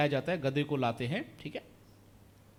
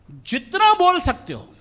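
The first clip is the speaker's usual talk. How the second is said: जितना stretched out high and loud; emphatic open invitation; 12 semitones higher, 9 dB louder, slower than usual. Retold or imitated. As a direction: draw out जितना, loud and high, then let it fall. Encouraging nervous beginners to speak freely.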